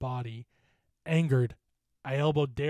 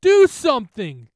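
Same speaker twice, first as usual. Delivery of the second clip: high yell building louder; desperate shout; 14 semitones higher, 16 dB louder than usual. Acted. Bitten bully shouting at friends to act. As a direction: demand help at the top of your voice. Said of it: "Derek shouted".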